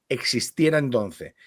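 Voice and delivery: ordinary talk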